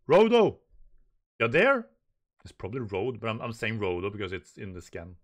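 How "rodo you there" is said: much louder, higher call. Acted. Giant knocking and calling for Rodo loudly. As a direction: call through the door to Rodo.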